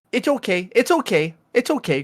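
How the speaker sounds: calm the angry driver down; soothing. Retold or imitated. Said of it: "she'll be like".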